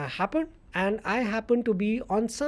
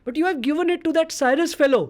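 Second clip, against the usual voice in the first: higher, fast retold outburst; indignant complaint; 7 semitones higher, 4 dB louder, faster than usual. Cricket legend scolds reporter over the phone, mock indignant. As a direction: imitate an irritated caller in a rush.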